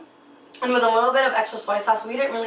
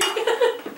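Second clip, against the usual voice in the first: high laugh; 11 semitones higher than usual. Real, not acted. Laughing while chopping onions.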